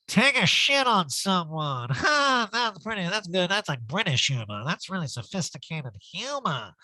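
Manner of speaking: drawled, hammed-up self-amused voice; mock crude joker. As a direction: pretend to be tickled by dirty humor. Recapper mimics Erica's crude joking.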